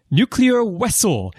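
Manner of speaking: high-pitched mock Russian accent, "vessel" starting with a W sound; Chekhov's Russian accent. Host quoting the show's odd W pronunciation, mock Russian accent. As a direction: imitate a Russian accent, saying "vessel" as "wessel". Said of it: "with a Russian accent, which mysteriously pronounces "w".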